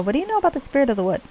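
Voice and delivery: quick, high pixie voice. Acted. Player questioning the wisp, quick high pixie voice. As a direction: rattle it off as a high little pixie. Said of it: "pixie voice when I'm not in character".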